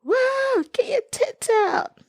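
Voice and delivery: high, far-off rowdy mock cheer. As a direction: hoot it like a crowd at a pub. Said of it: "You just like".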